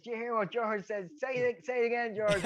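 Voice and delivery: high, eager call to the lads; pushing George to say it again; mock-excited high call to the lads; excited, egging on. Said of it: "turned around to the lads and says".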